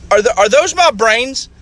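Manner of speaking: put-on high, puzzled child voice; little boy. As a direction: ask innocently like a small boy. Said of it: "So little Johnny's sitting in the bathtub".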